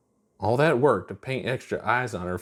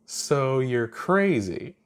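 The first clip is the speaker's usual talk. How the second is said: slow teasing; 4 semitones higher, much slower than usual. A narrator's reading. Chuck grinning, teasing.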